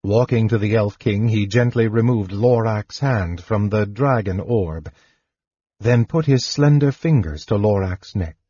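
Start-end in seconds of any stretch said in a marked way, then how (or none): none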